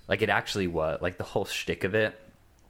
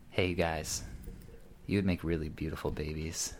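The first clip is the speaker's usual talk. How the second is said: quiet, calm, earnest voice; suddenly serious drunk; 6 dB quieter than usual. Retold or imitated. Drunk guy suddenly calm and serious to the couple, quiet. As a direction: drop to a calm, serious hush. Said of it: "gets real serious all of a sudden, this very calm".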